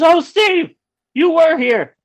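loud, very high-pitched imitation of his date; imitated excited call-back. Date spotting him across the theater, excited surprise. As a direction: squeal back across the crowd, mimicking her.

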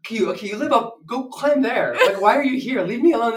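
hammed-up leave-me-alone rant; exasperated pleading. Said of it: "I'm like".

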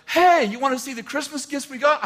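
high-pitched imitation of chirpy arrivals; cheery greeting. Girls walking in late to a worried dad, cheery greeting. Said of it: "They're like".

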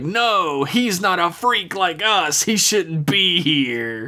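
theatrical high-pitched protest; protesting ensign. Retold or imitated.

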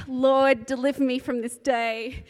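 loud, hammed-up cry to the Lord; mock desperate prayer. Soaked at the bus stop, she hams up a loud plea to God. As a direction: throw it heavenward like a melodramatic plea.

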